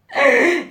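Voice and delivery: high loud gasp of alarm; shocked gasp. Retold or imitated. Teacher seeing the fall mark far below, gasping.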